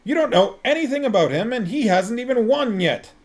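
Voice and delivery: fast heated outburst; angry protest. A narrator's reading. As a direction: rattle it off, still shouting. Vimes keeps shouting.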